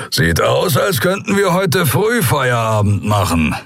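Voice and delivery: lightly voiced rumbling guard; a hint of a bored growl; grumbling Skria. A narrator's reading. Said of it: "brummte der Skria".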